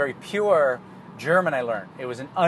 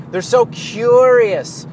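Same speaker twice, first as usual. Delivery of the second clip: They're so curious, slow and much louder on "curious"; louder, emphatic repeat; 6 semitones higher, 8 dB louder, much slower than usual. Real, not acted. Uncle gushing about his nieces, louder and slower. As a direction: repeat it with more force, drawing out "curious".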